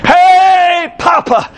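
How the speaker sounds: put-on high, stretched-out child's call; grandson's excited greeting. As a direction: imitate a little boy hailing his grandpa. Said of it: "Little Noah called me up".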